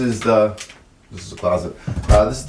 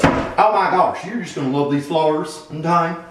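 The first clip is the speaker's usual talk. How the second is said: higher-pitched imitation of a realtor; gushing realtor; 6 semitones higher than usual. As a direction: mimic a realtor's gushy sales pitch. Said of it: "My realtor came in here and she goes".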